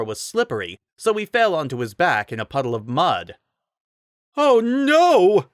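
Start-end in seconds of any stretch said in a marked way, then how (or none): 4.4-5.5 high, loud squeal of dismay; panicked shriek. A narrator's reading. Rider falling in mud, shrieking high and loud. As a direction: shriek in horror. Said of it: "the rider shrieked".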